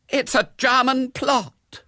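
high, slow, horrified gasp; aghast, high-pitched revelation; gasped realisation. A narrator's reading. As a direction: gasp out the dramatic theory. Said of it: "she gasped".

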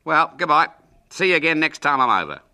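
loud, high, hearty farewell; calling goodbye. Acted. Uncle leaving William by car, calling out heartily. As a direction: call out a cheery goodbye.

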